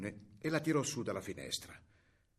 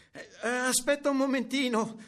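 put-on high, louder stalling child voice; girl calling back; 10 semitones higher, 6 dB louder than usual. A narrator's reading. Girl stalling grandmother from the stable, high call back.

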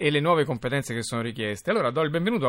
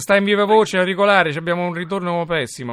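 loud interrupting; 6 semitones higher, 6 dB louder than usual. Real, not acted. Host cuts in over bad line.

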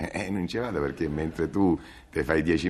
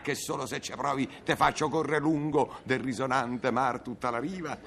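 shrill angry threat; 7 semitones higher than usual. Acted. Escalating quarrel, high.

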